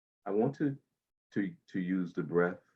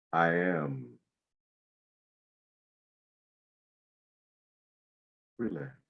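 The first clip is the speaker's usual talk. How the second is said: guided meditation. Acted. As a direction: ease them into calm breathing. Guides body-scan meditation.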